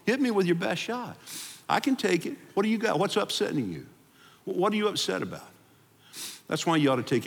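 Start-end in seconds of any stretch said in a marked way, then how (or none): 0.0-5.5 high, bold invitation, hit me; confident God. Pretend. Pastor voices God inviting angry prayers, high and bold. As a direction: dare them to bring it on. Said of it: "He's going".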